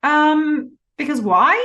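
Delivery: high, loud "Um", then a questioning "why"; pause before why. Retold or imitated. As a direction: hold back, then lift "why" as a stunned question. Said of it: "she pauses before that why".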